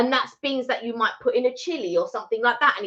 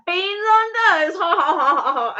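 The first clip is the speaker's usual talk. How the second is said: higher mock scoff with fake ha ha ha; mocking laughter; 8 semitones higher, 4 dB louder than usual. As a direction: sneer at the dish like the Americans did. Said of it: "They were like".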